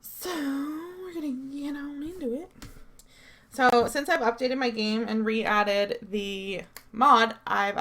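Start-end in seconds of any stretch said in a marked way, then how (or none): 0.0-2.5 whispered. Real, not acted. Whispered aside before loading game.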